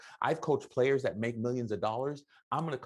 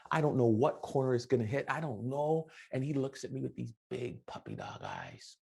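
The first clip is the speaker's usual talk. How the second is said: normal talking voice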